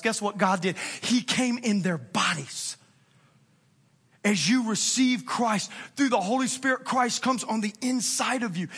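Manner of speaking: normal talking voice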